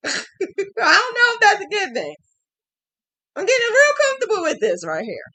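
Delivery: high, loud giggly delivery; laughing. Real, not acted. Admitting she's getting comfy on camera, laughing. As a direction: laugh through it, a bit giddy.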